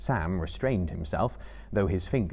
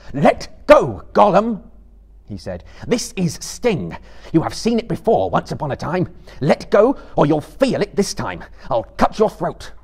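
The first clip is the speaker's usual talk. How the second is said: loud, higher, fierce threat; stern commanding shout; 9 semitones higher, 11 dB louder than usual. Acted. Frodo threatening Gollum with his sword, shouting fiercely. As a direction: order him to let go or die.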